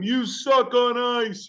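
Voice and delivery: high, drawn-out heckler's jeer; imitated crowd taunt, stretched out; mock crowd yelling. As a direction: jeer slowly in a high voice like a ballpark fan. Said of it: "they're screaming at him, yelling at him".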